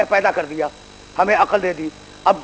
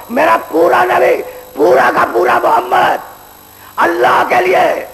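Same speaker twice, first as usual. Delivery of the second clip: full-force preaching; 8 semitones higher than usual. Acted. Preacher warns the young men about judgement day, in full-force preaching. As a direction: thunder it from the pulpit.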